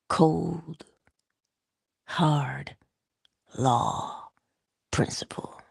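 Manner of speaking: each word whispered apart, fading away; whispered emphasis. Acted. Speaker stressing a hard truth in whispers.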